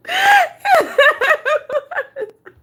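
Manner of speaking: high, shouted cackle; screaming laughter, pitched high; loud shrieking laugh. Real, not acted. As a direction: howl with laughter. Cracking up at her.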